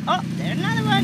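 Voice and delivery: ordinary delivery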